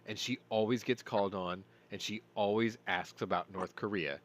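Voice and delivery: ordinary talk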